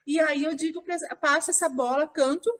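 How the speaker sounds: usual speaking voice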